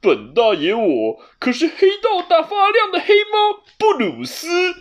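high, loud competitive cat with a snicker; refusing to lose. A narrator's reading. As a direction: top his boast and snicker. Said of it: "不认输地说". (不服输的猫，高声响亮地回嘴)

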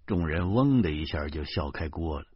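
plain reading voice

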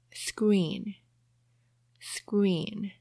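whispered screen, screen. Shown as a demonstration. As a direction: breathe the word screen. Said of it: "teacher whispers the word".

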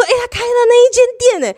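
high, excited exclamation of a new shop; excited surprise. Retold or imitated. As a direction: blurt it out, thrilled and surprised.

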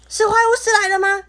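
高声惊慌地问; 吓坏了的尖声提问. Acted. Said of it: "小智吓得头发都竖起来了". (惊恐发问)

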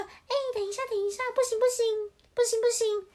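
alarmed storybook mum calling a stop; urgent mother hen. Acted. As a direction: cry out to halt the little ones. Mother hen stops the chicks.

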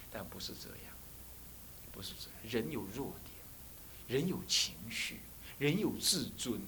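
soft, slow, almost whispered; hushed and earnest. Real, not acted. Solemn lesson point, hushed. (轻声缓慢，语重心长)

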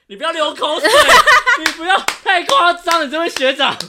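playful scolding. Real, not acted. Teasing a drooling friend.

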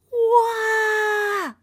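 high amazed wow; startled cry. Acted. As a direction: gasp at the darkening sky. Storm begins.

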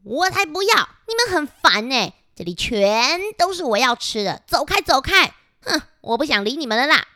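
high, whiny refusal, pushing everyone away; sulky bratty shove-off; 任性的小蟑螂. Acted. 蟑螂阿张耍脾气拒绝分享. (高声任性地赶人走)

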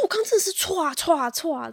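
retold whoosh noise of water breaking; mock gush sound. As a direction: imitate the sudden gush. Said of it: "我不太形容". (模仿破水的“歘”声)

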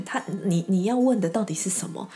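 ordinary talk

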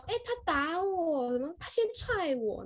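put-on high, slow whine of a child telling on a friend; slow, high childish tattle; whiny tattling kid. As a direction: draw it out like a kid complaining to the teacher. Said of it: "跑来跟我告状".

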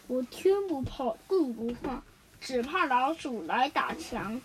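slow, low, sheepish confession; embarrassed wall. A narrator's reading. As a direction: admit a weakness bashfully in a slow voice. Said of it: "墙很不好意思地说".

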